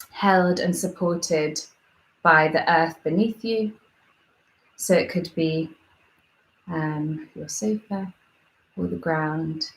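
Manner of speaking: calm, measured meditation guide with long pauses; guided meditation. Real, not acted.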